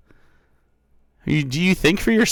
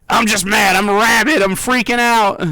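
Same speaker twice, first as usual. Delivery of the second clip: loud, raised mock rant; mock freak-out; 4 semitones higher, 7 dB louder than usual. Streamer acting out being mad at a chatter, mock freak-out. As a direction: pretend to lose it, loud and high.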